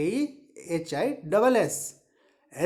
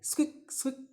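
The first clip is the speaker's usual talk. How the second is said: tiny, very soft squeaks; little short mouse squeaks; 5 semitones higher, 8 dB quieter, faster than usual. Shown as a demonstration. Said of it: "ऐसे".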